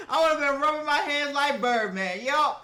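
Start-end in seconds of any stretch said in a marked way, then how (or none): none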